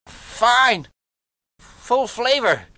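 high, strained, forced approval; through shut teeth. A narrator's reading. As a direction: squeeze it out through clenched teeth. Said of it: "he answered through shut teeth".

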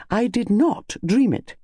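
high, slow, firm insistence. Acted. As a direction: insist on every word, unbudging. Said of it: "said Missus Bantry firmly".

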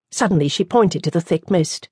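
usual speaking voice

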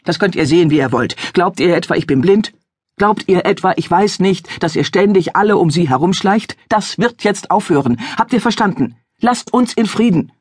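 father drives the stranger away, growing angrier; angry father. Acted. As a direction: hammer the questions at the stranger.

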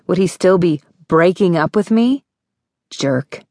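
plain reading voice

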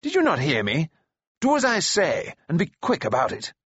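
high, imperious demand; haughty prince losing patience with a servant; Ellidyr. A narrator's reading. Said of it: "Ellidyr commanded".

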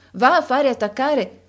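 high, loud, sharp command; impatient order. Acted. Countess ordering Lisa to get the carriage, high and impatient. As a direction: bark the order at the girl. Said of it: "gridò la contessa".

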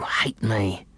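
ordinary delivery

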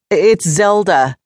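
mildly firm correction; correcting. A narrator's reading. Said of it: "I corrected her".

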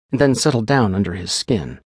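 ordinary delivery, nothing marked

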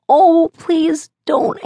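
put-on high, slow pleading little boy; sleepy child voice. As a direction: beg sleepily like a small child. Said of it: "Cooper's sleepy voice pleaded".

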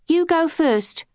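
put-on a young twin urging her sister on; lightly voiced young sister; child Kat. A narrator's reading.

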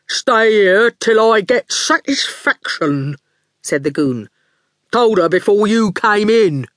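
strong daft voice. A narrator's reading. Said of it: "He spoke in a strong, daft voice".

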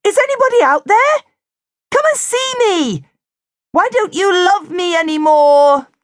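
high, loud pleading call; desperate calling. A narrator's reading. Lonely penguin begging the camera for visitors, high loud plea. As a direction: call out to anyone who might hear.

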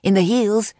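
usual speaking voice

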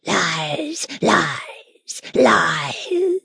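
half-whispered hoarse jeer; hoarse, indistinct voice. A narrator's reading. Unseen creature jeering at the nurse, hoarse and indistinct. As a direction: rasp it out indistinctly, mostly whispered. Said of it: "a hoarse, indistinct voice".

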